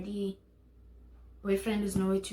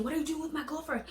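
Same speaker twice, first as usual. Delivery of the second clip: a hint of an angry boyfriend yelling, quick and higher; mild mock yell; 7 semitones higher, 4 dB quieter, faster than usual. Boyfriend charging at her friend, mild mock yell. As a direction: fire it off fast like a jealous boyfriend.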